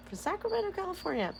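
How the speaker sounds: mock-announce the hometown; lightly voiced fight intro; fight announcer.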